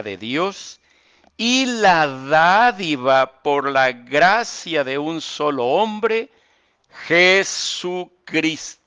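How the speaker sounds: slow, high, loud scripture proclamation; fervent preacher reading, high and loud; preaching at full force. Acted. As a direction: build the verse to a proclaiming climax. Reads scripture with rising force.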